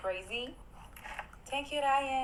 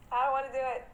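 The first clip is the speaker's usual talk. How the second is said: ordinary talk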